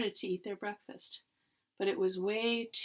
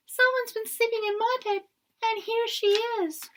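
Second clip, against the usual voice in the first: put-on high squealy Baby Bear; 11 semitones higher, 6 dB louder than usual. A narrator's reading. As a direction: squeal like a tiny cub.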